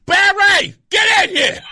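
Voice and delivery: high, loud yell for a husband; wife's shout. Retold or imitated. Retold wife calling her husband into the room, shouting. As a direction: holler through the house, acting the alarmed wife.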